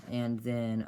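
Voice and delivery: ordinary delivery, nothing marked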